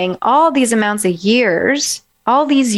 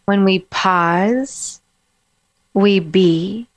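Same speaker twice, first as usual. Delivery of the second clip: slow calm pause; 2 semitones lower, much slower than usual. Shown as a demonstration. Coach guiding listeners into a calm pause. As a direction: let each phrase breathe. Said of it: "there's a different energy".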